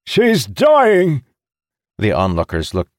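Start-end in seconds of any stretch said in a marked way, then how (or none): 0.0-1.2 high, loud, anguished cry; desperate shout. A narrator's reading. Captain telling onlookers his ship is dying, shouting. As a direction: cry out for help. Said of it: "he shouted to the onlookers".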